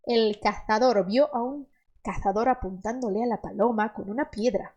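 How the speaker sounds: hammed-up Castilian accent with lisped Z; Spain Spanish accent. Teacher jokingly mimics a Spaniard: hammed-up Castilian accent. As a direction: play a Spaniard from Spain, TH for every Z. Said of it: "Assim diria o espanhol".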